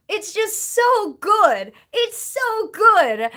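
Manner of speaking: gushing. Real, not acted. Gushing about loving Hamilton.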